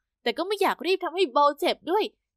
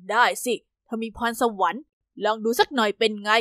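lower, easy fatherly voice; a dad saying yes at once, voiced lower; encouraging father; 6 semitones lower than usual. A narrator's reading. Father encouraging daughter to race, lower and easygoing. Father answers without hesitation.

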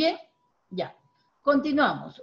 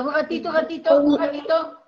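un ratito called out louder and slowed; firm call to stop; 4 semitones higher, 5 dB louder than usual. Real, not acted. Teacher stopping a pupil mid-reading, calling out firmly. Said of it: "teacher halting the reading".

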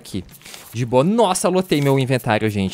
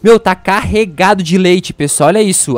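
loud, slow amazed exclaim; 4 semitones higher, 7 dB louder than usual. Real, not acted. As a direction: marvel out loud. Amazed at goat milk.

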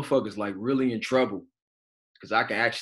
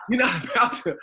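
laughing; 13 semitones higher, 4 dB louder than usual. Retold or imitated. Retelling standoff with the doctor.

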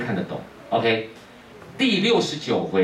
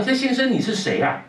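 much higher youthful voice; young farmer; 13 semitones higher than usual. Acted. Young man asking who the stranger is, in a much higher voice. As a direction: pitch it up as the puzzled youth. Young man's reply, paraphrased.

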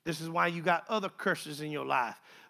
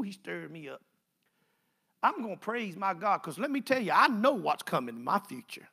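usual speaking voice

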